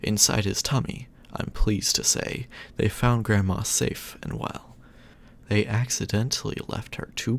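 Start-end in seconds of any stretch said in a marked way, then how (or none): none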